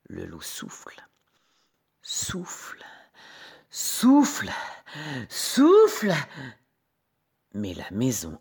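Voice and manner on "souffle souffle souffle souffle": building huffs. A narrator's reading. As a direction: blow harder on each repeated word. Narrator acts out the blowing. (souffle de plus en plus fort)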